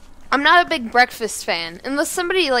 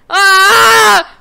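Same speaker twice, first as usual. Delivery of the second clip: high, loud scream; sudden full-volume shriek; startled scream; 9 semitones higher, 13 dB louder than usual. Real, not acted. Player hitting trouble in the game, high loud scream.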